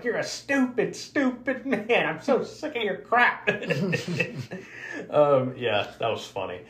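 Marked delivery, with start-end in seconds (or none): 0.0-3.5 hammed-up cartoon mom nagging her husband; Lois scolding Peter. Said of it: "She's like". 3.5-5.0 laugh. Real, not acted. Laughing at own impression.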